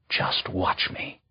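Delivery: quiet threat. Acted. Queen's defiant threat.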